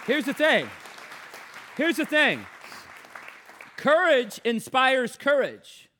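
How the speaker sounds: preaching. Acted. Preaching to congregation.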